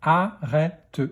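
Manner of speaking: "Arrête" split into a, rè, te; "Arrête" in three separate beats; syllable by syllable. Shown as a demonstration. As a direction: sound out "Arrête" with a final te. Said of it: "dans quelle syllabe".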